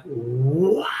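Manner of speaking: drawn-out, rising mock-mad question; mock outraged disbelief. Jo reacting to stories thrown in the fire, mock outraged disbelief. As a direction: stretch it out and swoop upward, incredulous. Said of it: "Joe is just mad".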